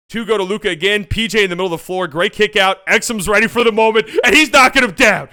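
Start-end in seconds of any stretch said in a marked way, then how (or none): 2.1-5.3 higher, louder hype call; high, loud hyped commentator; excited play-by-play. Acted. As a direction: call the shot like the arena is exploding. Live call of clutch shot.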